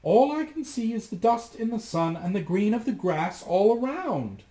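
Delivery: sister's reply. A narrator's reading. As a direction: answer down from the tower, sing-song. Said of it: "her sister would reply".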